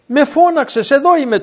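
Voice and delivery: put-on a boy hurrying to answer his master; eager boy's call.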